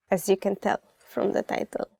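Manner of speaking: usual speaking voice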